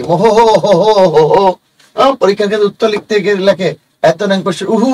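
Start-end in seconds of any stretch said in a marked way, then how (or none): 0.0-1.5 reads মোহ হো হো হো হো হো as a long drawn laugh; mock reading. Shown as a demonstration. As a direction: sound out the visarga as a silly ho ho. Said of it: "তার মানে কি?".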